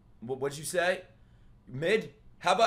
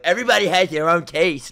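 louder, raised mocking quote; mock YouTube commenter; 4 semitones higher, 6 dB louder than usual. Streamer imitating his commenters, mocking. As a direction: parrot a commenter's protest mockingly. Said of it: "comments are gonna be like".

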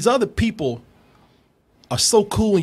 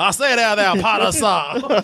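mock Cajun Voldemort, a bit higher; 3 semitones higher, slower than usual. Joking host voicing Cajun Voldemort to Potter. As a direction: sneer it like a Cajun dark lord. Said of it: "Cajun Voldemort".

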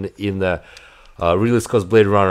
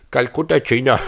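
mock Indian accent; 2 semitones higher than usual.

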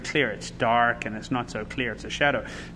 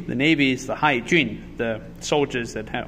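usual speaking voice

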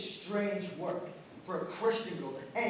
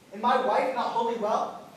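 louder, indignant scolding question; angry rebuke; 4 semitones higher, 9 dB louder than usual. Acted. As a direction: snap it at her in anger. Master scolds servant.

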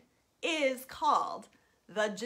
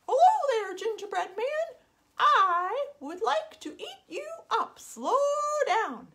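high, loud hen voice; clucky hen; 12 semitones higher, 5 dB louder than usual. Acted. Hungry hen greeting the gingerbread man in a high voice. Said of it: "the hen said".

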